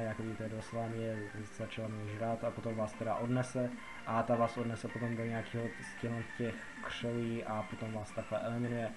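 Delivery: usual speaking voice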